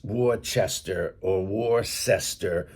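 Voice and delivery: "Worcester" as "WOR-chester", then "wor-SESTER"; two mistaken takes of "Worcester", chester then sester; wrong pronunciations. Shown as a demonstration. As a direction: read "Worcester" by spelling, first CH, then S. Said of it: "it's not pronounced".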